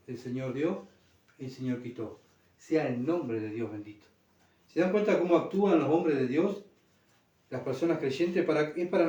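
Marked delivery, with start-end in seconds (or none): 0.1-4.0 much softer, lower reverent acceptance; humble reverence. Pretend. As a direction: say it hushed and surrendered. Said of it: "vamos a decir". (reverencia humilde, en voz baja y grave)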